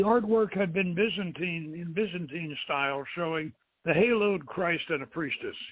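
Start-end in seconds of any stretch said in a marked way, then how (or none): none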